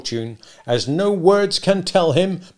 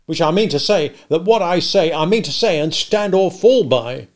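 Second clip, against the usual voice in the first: higher, louder blustering blacksmith squaring up; angry challenge; 6 semitones higher, 7 dB louder than usual. Acted. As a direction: roar it out, daring him to fight. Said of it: "cried Joe".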